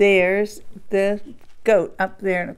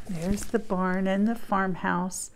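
ordinary delivery, nothing marked

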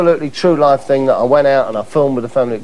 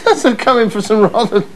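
teasing laugh; 9 semitones higher, slower than usual. Acted. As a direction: needle him gleefully, laughing. Mock teasing of interviewee.

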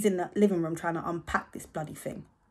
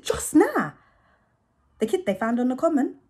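higher and louder, insisting; insistent retort; 8 semitones higher, 9 dB louder than usual. Acted. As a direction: throw it back at her. Kid retorting to Mum.

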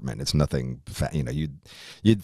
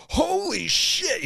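high, slow, stunned exclamation; shocked; much slower than usual. Retold or imitated. First bite of horseradish burns, high slow shock. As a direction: gasp it out as the heat hits.

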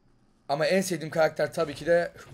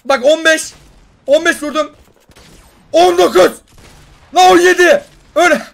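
high, loud damage numbers yelled; excited callouts; 12 semitones higher, 18 dB louder than usual. Real, not acted. Gamer yelling damage numbers to viewers, thrilled. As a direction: call each hit out at full pitch.

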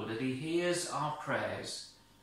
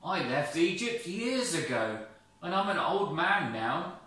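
put-on higher, worried old shepherd; pleading Moses; 5 semitones higher, 5 dB louder than usual. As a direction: protest feebly. Moses protests.

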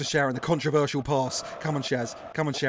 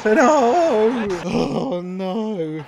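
loud high cry of no; anguished no; 7 semitones higher, 8 dB louder, much slower than usual. Real, not acted. Fan watching a chance missed, loud anguished cry. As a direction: wail it in despair.

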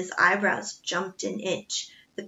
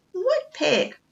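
feigned innocence; 10 semitones higher than usual. A narrator's reading. Butler feigns innocence to cook.

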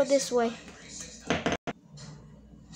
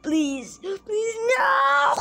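rising, higher pleas into a screamed no; terrified begging; 10 semitones higher, 5 dB louder, much slower than usual. Acted. Victim begging the killer in the woods, rising to a scream. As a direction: beg for your life, climbing to a shriek. Character begs in horror game.

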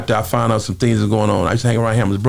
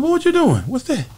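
high, startled question; retelling his own startled reply much higher; retold surprise; 14 semitones higher than usual. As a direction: act caught off guard by the cash. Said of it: "I said".

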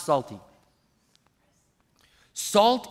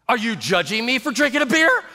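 raised, accusing question; mock indignation; 5 semitones higher than usual. Drinker feels judged by the pastor, mock indignation. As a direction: act the touchy drinker, pitch climbing. Acted offended beer drinker.

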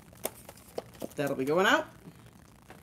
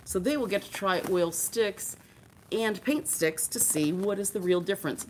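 ordinary talk, nothing marked

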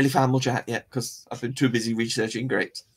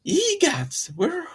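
ordinary talk, nothing marked

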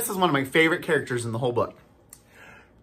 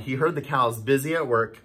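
ordinary delivery

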